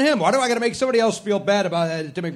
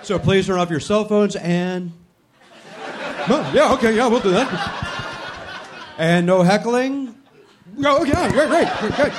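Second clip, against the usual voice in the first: mocking the announcer; 2 semitones higher than usual. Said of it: "there was a weird pause".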